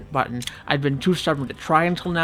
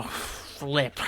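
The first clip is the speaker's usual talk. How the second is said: "flip" breathed out much softer under the breath; muttered curse; 10 dB quieter than usual. Real, not acted. Gamer alone at his desk, fuming over ruined footage, curses very softly. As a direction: swallow the curse "flip" almost silently.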